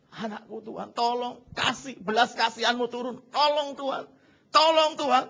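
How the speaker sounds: ratapan bernada tinggi, makin keras; pura-pura menangis memohon. Acted. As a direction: meniru ayah meratap minta tolong. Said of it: "dia nangis sama Tuhan. Dia teriak". (meratap memohon dengan nada meninggi)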